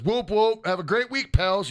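high, bright reading voice; upbeat chat read. Pretend. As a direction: hype up the message. Reading chat message aloud.

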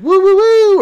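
very high, loud imitated child's whoops; happy kid screech. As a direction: mimic a child squealing happily upstairs. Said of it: "little happy screechy sounds that kids make".

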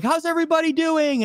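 high, big ring announcer call to the crowd; ring announcer hype. Retold or imitated. Ring announcer greeting the packed Brampton crowd, big and high.

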